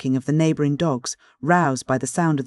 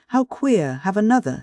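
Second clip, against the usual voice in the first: urging Charlotte; 6 semitones higher than usual. A narrator's reading.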